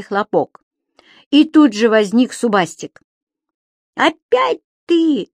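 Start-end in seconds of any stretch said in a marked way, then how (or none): 4.0-5.3 high, drawn-out outraged cry; indignant shout. Acted. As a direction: yell it, fed up at the sight of him. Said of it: "возмущённо закричал Субастик".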